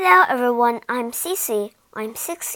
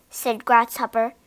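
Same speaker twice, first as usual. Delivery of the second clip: ordinary delivery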